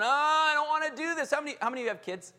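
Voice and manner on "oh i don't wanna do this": whiny flesh. Pretend. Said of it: "that's your flesh crying out".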